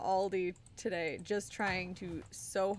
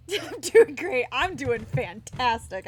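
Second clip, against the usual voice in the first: sarcastic; 3 semitones higher, 11 dB louder than usual. Pretend. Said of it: "I don't know what you're talking about".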